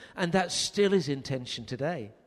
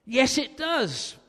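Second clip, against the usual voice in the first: higher, slow, curt and irritated; a bit annoyed; 10 semitones higher, 6 dB louder, slower than usual. Retold or imitated. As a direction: snap back impatiently. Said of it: "a bit annoyed".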